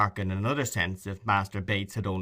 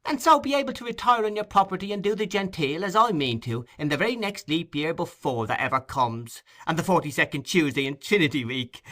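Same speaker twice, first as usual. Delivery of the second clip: lightly voiced cheeky urchin, pitched higher; a hint of a joking street boy, pitched up; cheeky boy Charlie; 8 semitones higher, 3 dB louder than usual. A narrator's reading. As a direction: Charlie teasing Oliver with a far-fetched joke, lightly voiced higher. Charlie Bates voiced by narrator.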